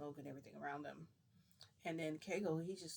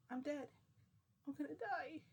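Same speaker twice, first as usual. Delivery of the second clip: much higher panicked wail; 11 semitones higher than usual. Retold or imitated. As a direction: squeal it in sheer panic. Panic after kill order.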